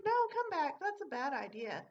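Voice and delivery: to the supervisor running after the robber; calling after her. Retold or imitated. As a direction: shout for her to come back. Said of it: "And I was like".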